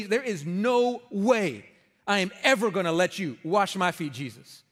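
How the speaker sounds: very slow, stressed "there is no way"; emphatic refusal. Shown as a demonstration. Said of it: "That would have been the emphasis".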